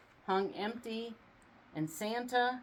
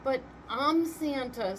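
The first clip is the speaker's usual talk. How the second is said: higher, louder insistence; insistent; 6 semitones higher, 4 dB louder than usual. A narrator's reading. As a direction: insist on who you are. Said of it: "said Santa".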